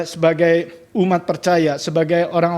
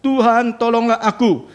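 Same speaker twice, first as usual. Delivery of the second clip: cry for help; 6 semitones higher than usual. A narrator's reading. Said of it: "lalu berteriak".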